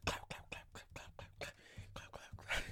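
breathy whispered clap clap; whispered clapping. Retold or imitated. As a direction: whisper the claps. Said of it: "everybody be like".

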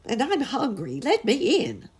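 high, loud plea to be let in; crying out at the door. A narrator's reading. Cold stranger begs boys to let him in, loud and high. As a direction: beg at the door, rising to a cry. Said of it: "cried a voice".

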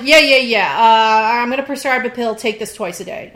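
imitating a doctor's brush-off, loud at first; uncaring doctor. Older doctor brushing off a patient, uncaring. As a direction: wave the patient off with a mock dismissal. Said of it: "care. They're just like".

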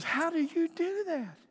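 put-on very high, held-out childlike question; amazed child. Son asks dad once the men have left, high amazed voice. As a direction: imitate an awestruck boy in a high stretched voice.

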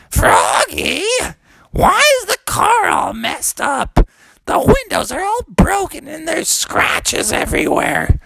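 very high, wailing mother voice; shrieked motherly dismay; upset mommy frog. Acted. Mom sees the wrecked car, upset wail.

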